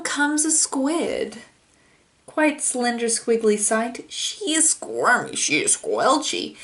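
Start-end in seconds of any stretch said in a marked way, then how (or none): none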